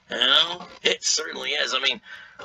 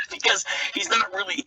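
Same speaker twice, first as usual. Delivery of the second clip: ordinary talk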